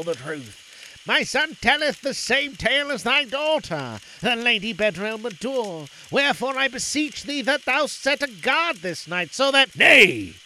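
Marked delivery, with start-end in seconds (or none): 1.0-9.8 oily scheming vizier. A narrator's reading. Vizier pleads with the Sultan. 9.8-10.3 sharp loud royal "Nay"; angry interruption. A narrator's reading. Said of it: "broke in the Sultan angrily".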